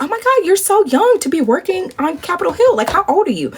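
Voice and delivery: high, marvelling admiration; hammed-up friendly amazement; amazed gushing. Voicing Michelle's amazed reaction.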